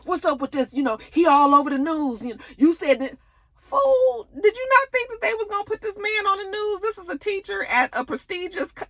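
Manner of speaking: high agitated voice, quick; panicked friend. Retold or imitated. Kane panicking about the news, high agitated voice.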